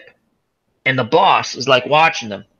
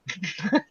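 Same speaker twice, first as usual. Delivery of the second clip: soft laugh; 3 semitones higher, 11 dB quieter than usual. Real, not acted. Laughing at awful scene.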